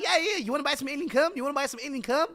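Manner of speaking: high-pitched pushy salesman impression; imitated alien-goods hawker. Streamer imitates a game character selling wares, high hawker voice. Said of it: "There's a guy selling alien cum".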